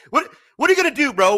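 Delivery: loud, fast mock dare; mock challenge. Host daring the viewers, loud and fast. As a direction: bark it out like picking a fight.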